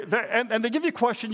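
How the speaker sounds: ordinary talk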